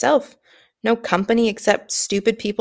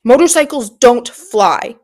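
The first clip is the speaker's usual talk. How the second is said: loud, slow furious bellow of an uncle; angry yell; 3 semitones higher, 6 dB louder, slower than usual. Acted. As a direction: schreeuw het woedend en traag naar Harry. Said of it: "yelled at Harry".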